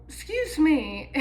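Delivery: slow, drawn-out excuse me; mock indignant. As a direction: play it offended, tongue in cheek.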